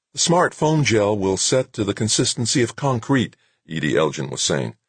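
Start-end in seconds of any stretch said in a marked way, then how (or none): 0.1-3.4 higher-pitched broadcast report; lightly voiced news anchor; strained newscaster. A narrator's reading. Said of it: "voice sounded strained, slightly hoarse".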